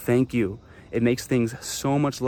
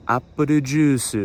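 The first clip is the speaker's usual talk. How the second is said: Japanese pronunciation; 2 semitones higher, much slower than usual. Shown as a demonstration. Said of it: "something says".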